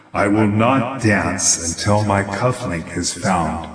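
low gravelly wooden lad voice; a low gravel growl, steady and firm. Acted. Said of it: "in a gravelly voice".

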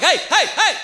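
high, repeated calls to cut in; mock-urgent attempt to get her attention; urgent calming interjection. Coach trying to halt frantic mom.

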